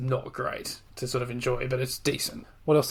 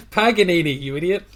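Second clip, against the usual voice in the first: louder, higher "Paganini" through laughter; mock correcting; 4 semitones higher, 7 dB louder than usual. Host pretending to correct an Italian listener, laughing mock rebuke. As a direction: jokingly scold someone for mispronouncing it. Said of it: "and then correct them".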